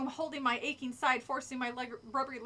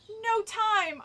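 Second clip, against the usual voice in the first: high, loud urgent cry; 9 semitones higher, 6 dB louder than usual. A narrator's reading. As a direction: cry out that there is no time. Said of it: "I cried to Alex".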